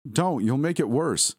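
high, drawn-out alarmed plea; distressed and insistent; urgent warning. Acted. Wife stops husband hurting himself.

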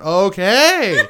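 loud, high laughing okay; child saying okay, laughing. Retold or imitated. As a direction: blurt okay through a laugh. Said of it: "how she says".

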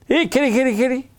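high sing-song calling out; calling the cat. Acted.

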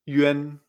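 YUAN as a long level "yü-en"; corrected pronunciation. Shown as a demonstration. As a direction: model YUAN slowly, e instead of a. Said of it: "sino que se pronuncia".

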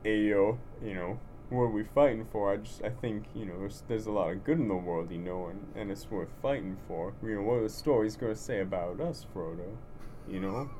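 lightly mumbled Philly Rocky accent; hammed-up Rocky Balboa accent, loose and slurred. Shown as a demonstration. Rocky Balboa accent playing Samwise talking to Frodo. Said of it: "Rocky Balboa playing Samwise Gamgee".

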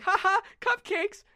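very high gleeful gnome; high-pitched little cackle and cheer; gnome queen. Acted. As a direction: crow it in a squeaky gnome voice. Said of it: "he's like".

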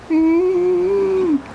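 squeaky high hum; a shrill little hum for the soup. Shown as a demonstration. Showing a stranger his soup impression, a high hum. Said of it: "see my cream of tomato".

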